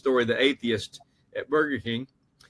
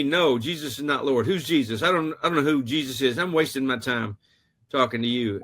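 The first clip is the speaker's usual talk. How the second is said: ordinary talk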